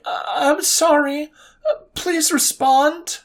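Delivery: high, halting, pleading voice; nervous pleading messages to Coleman; needy, desperate messages. A narrator's reading. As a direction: read the texts as an insecure, clingy girl. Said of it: "how disgustingly desperate and needy the messages".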